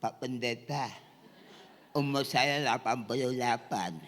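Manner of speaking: soft, put-on old man's voice; elderly retired pastor. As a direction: imitate a frail retired minister. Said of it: "Ada pendeta sudah emeritus". (suara pendeta tua pensiunan)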